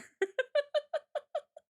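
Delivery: high, quick laughter; giggle. Real, not acted. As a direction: giggle at your own joke. Laughing at own joke.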